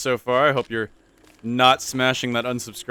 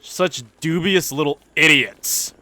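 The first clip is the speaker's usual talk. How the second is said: higher, louder, slow scornful voice; mock snooty sneer; 7 semitones higher, 6 dB louder, slower than usual. Gamer mocking the new neighbor, slow snooty sneer. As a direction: sneer at the dubious little idiots. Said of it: "put-on second take".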